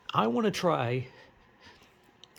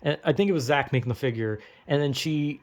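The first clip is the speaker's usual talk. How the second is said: ordinary delivery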